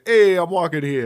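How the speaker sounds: put-on New York accent, punchy and loud; New York street shout. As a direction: holler it like a New York pedestrian. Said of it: "kind of a Christopher Walken accent, but it's more of like a".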